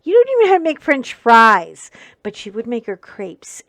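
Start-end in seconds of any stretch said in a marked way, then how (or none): 0.0-1.9 loud, scornful comeback; kid's loud retort to a snobby mother; retorting loudly. Retold or imitated. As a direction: fling it back at her loudly. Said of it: "And I was like".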